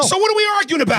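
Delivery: very high, rapid-fire question; real incredulous anger; exasperated outburst. Real, not acted. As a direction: burst out in frustration. Heated argument with co-host.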